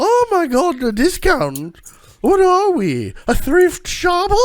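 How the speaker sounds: high, loud haughty noble lady voice; snooty noblewoman. Acted. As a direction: look down your nose at the shopkeeper. Noblewoman mocking discount request.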